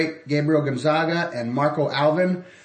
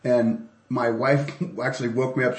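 ordinary talk, nothing marked

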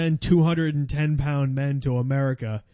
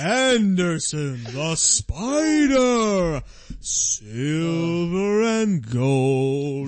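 very slow, drawn-out ring announcer; Bruce Buffer; 2 semitones higher, much slower than usual. Acted. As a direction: stretch each name like a fight introduction. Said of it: "Bruce Buffer goes".

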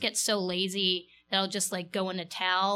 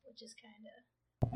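whispering; 12 dB quieter, slower than usual. Real, not acted. Worried about boss hearing joke.

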